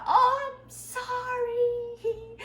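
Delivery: very high, long drawn-out giggly sorry; embarrassed smiling apology. Acted. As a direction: act out apologizing through an embarrassed laugh. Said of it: "웃으면서". (웃으면서 높고 길게 늘인 사과 흉내)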